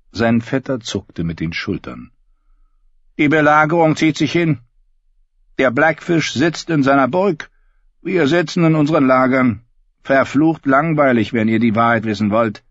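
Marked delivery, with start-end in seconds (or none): 3.2-12.6 high, loud bleating sheep voice. Acted. Davin voiced by narrator.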